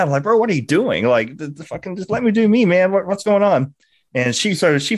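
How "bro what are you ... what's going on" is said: exasperated "what are you doing" at a cockblocking friend; retold mad outburst. Angry at the friend who cut in, higher and heated. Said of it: "And I was mad".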